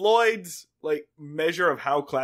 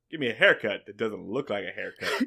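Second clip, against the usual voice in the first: lower, softer, smooth and sly; smooth winking charmer; 8 semitones lower, 5 dB quieter than usual. Retold or imitated. Roger Courtney asking the barber, lightly voiced smooth charmer. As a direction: purr it with a wink and a finger gun. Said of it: "impeccably smooth and just, like, winks".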